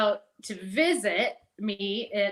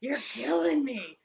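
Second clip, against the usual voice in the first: slow, rough mock of an angry sick father; imitating sick dad's voice; 5 semitones higher, 4 dB louder, slower than usual. Dad scolding Debbie over the phone, imitated rough voice. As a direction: growl it out like her dying dad scolding her. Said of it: "his voice was like this".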